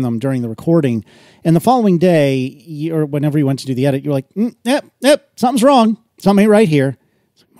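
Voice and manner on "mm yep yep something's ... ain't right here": high, pert mock detection; lightly acted suspicious alarm; editor spotting trouble. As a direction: mimic someone sniffing out a fault. Acting out editor spotting a problem.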